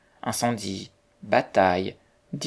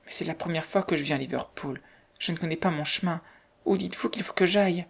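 soft, higher, anxious voice; lost young woman; 6 semitones higher, 3 dB quieter than usual. A narrator's reading. Newcomer asking her way in Liverpool, anxious and soft. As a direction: plead faintly, rising toward the end.

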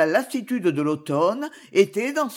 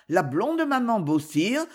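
ordinary delivery, nothing marked

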